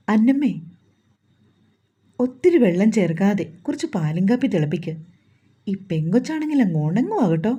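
usual speaking voice

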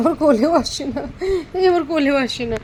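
laughing. Real, not acted. Repeats line with a laugh.

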